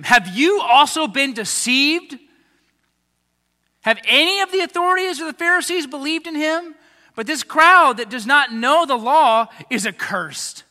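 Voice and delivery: loud contemptuous accusation; angry Pharisees. Acted. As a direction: scold them like proud leaders. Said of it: "look at the anger of the religious leadership".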